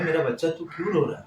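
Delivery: a hint of a mother soothing her crying son; mother's tender worry. Ailing mother asks her weeping son what happened, lightly voiced tender worry. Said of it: "मैंने".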